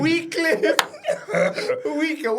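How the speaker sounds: high laughing repeat of the name. Real, not acted. Laughing at mispronunciation.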